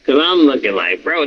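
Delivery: normal talking voice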